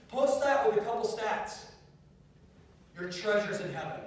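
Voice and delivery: rally the crowd into action; insistent preacher's exhortation; rousing preaching. Real, not acted.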